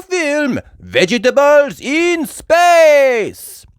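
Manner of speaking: high, loud, booming superhero voice; Super Potato. Acted. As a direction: announce it like a pompous TV hero, stretched out.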